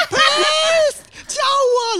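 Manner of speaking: high, loud pleading shout; desperate plea. Retold or imitated. Late passenger begging the crew to save him, pleading shout. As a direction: beg the staff loudly to save you. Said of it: "我这时候呐喊说".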